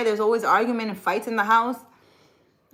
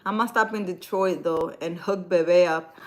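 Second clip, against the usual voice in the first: ordinary delivery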